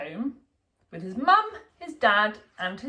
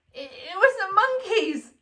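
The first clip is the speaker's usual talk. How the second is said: put-on laughing little boy; 10 semitones higher, 3 dB louder than usual. A narrator's reading. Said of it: "laughed Alfie".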